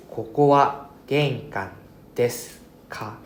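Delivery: ここは玄関 spoken very slowly, each syllable clear; teacher reads the textbook example to students, slowly; slow model sentence. Shown as a demonstration. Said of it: "teacher models example sentence".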